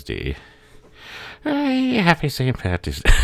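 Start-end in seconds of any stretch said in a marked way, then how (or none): 1.4-3.1 hammed-up high Irish accent, louder; a silly stage-Irish greeting, pitched way up; Irish impression. Shown as a demonstration. Mocking the Irish for Saint Patty's Day in a high, louder Irish accent. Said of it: "See, there's the accurate impression".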